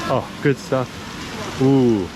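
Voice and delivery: usual speaking voice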